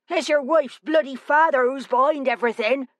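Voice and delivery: high-pitched, irate West Country accusation; riled-up West Country local; West Country accent. Acted. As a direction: announce it angrily to a crowd in broad West Country. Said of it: "his accent pure West Country".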